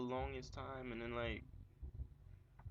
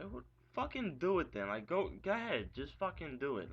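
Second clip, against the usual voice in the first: loud, higher retold outburst; angry shout; 7 semitones higher, 5 dB louder than usual. To girlfriend about Javi, a jealous angry shout. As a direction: bark it at her, jealous and furious.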